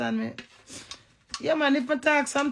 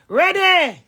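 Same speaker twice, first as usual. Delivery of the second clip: loud call; 8 semitones higher, 11 dB louder than usual. Real, not acted. Calls out to the listener.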